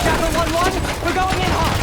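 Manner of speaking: urgent attack call to the squad; loud radio call. Acted. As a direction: call it over the noise of battle.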